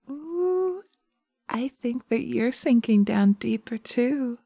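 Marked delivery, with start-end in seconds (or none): 0.1-0.8 delighted high coo. Acted. Witch delighted the listener sinks.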